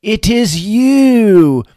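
loud, very slow mock French; slow, loud hammed-up French accent. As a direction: drag it out in a thick put-on French accent. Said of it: "I'm not gonna do the accent very long".

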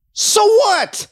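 high-pitched, exasperated comeback; dismissive retort. Real, not acted. Commentator brushing off promised hearings, exasperated retort. Scornful retort to a voiced objection.

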